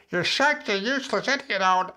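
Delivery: squeaky high silly voice; ridiculous voice. Shown as a demonstration. Coach making inner critic ridiculous, squeaky and high. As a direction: make the insult sound absurd. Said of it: "when you make it ridiculous".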